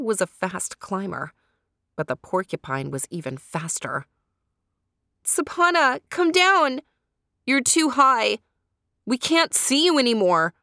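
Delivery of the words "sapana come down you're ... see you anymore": much higher, loud worried call upward; friends calling out. A narrator's reading. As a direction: call up the tree to a friend too high. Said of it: "her friends called out".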